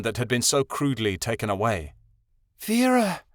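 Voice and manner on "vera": high, trembling whimper of a name; aching for a lost mother. A narrator's reading. Midnight Star whimpers her lost mother's name. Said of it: "she whimpered".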